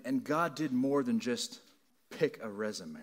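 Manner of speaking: ordinary delivery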